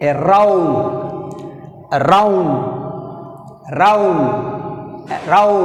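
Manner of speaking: "around" with a full, forceful ROUND; a-ROUND, "around" drilled with end stress; stress on second syllable. Shown as a demonstration. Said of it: "rising sound round".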